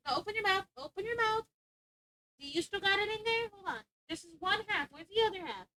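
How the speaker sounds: high, soft coaxing; coaxing the dog. Real, not acted. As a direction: gently coax the pup to let go. Coaxing dog to open mouth.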